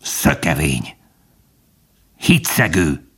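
low, composed accusation; bitter growl. A narrator's reading. Fox naming the dog deserter, bitter low growl. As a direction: growl it with sad disgust. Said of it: "szomorúan és elkeseredve morogta".

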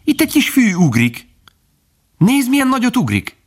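put-on very high, excited young fawn; izgatott kiáltás. A narrator's reading. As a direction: exclaim in high-pitched amazement. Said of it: "kiáltott Bambi". (magas, izgatott gyermekhang)

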